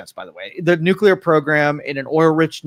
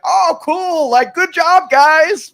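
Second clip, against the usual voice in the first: very high, gullible cheering; hammed-up naive praise, pitched way up; mock congratulations; 12 semitones higher, 4 dB louder, much slower than usual. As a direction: cheer them on with fake delight. Sarcastic imitation of gullible public reaction.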